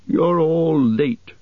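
high, drawn-out grumble; high, slow acted grumpy butler; grumbling. A narrator's reading. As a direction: complain slowly, stretching the words. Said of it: "he grumbled".

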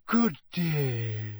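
airy mystic's greeting; misty voice. A narrator's reading. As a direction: float it out like a seer. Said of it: "the misty voice of Professor Trelawney".

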